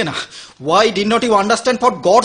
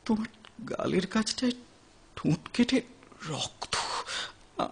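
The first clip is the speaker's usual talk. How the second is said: much softer, breaking to a whisper; stunned, barely able to say it; a horrified near-whisper; shocked hush; 14 dB quieter than usual. Acted. Shocked at seeing her bleeding.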